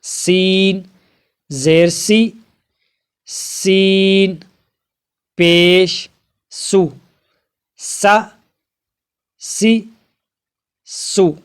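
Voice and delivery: slow, deliberate spelling-out of each letter and vowel; letter drill recitation. Shown as a demonstration. Teacher drilling students on Arabic letters, slow and deliberate. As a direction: sound out every letter like a teacher drilling children.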